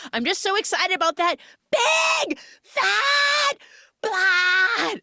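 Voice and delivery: high, loud, slow screamed cover; screaming. Retold or imitated. She screams to warn Naomi, high and loud. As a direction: yell it so the other girl hears. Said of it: "screams it at the top of her lungs".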